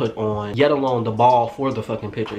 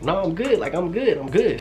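nervous refusal; 7 semitones higher than usual. Retold or imitated. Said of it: "I was like".

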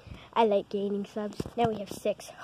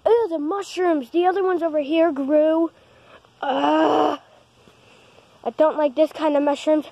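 grossed out; 5 semitones higher, 7 dB louder than usual. Real, not acted. Disgust at garden mushrooms.

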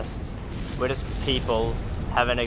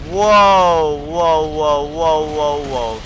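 startled whoas; 2 semitones higher, 6 dB louder, much slower than usual. Real, not acted. Sudden fire erupts in game.

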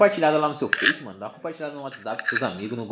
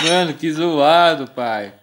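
laughing; 2 semitones higher, 7 dB louder, much slower than usual. Real, not acted. Laughs at game mishap.

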